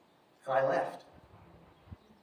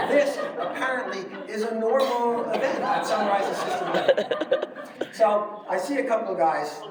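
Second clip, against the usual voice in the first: louder, talking through laughter; amused while recounting the scene; laughing; 6 semitones higher, 5 dB louder than usual. Real, not acted. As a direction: laugh as you tell it, amused disbelief.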